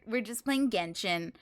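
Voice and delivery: ordinary delivery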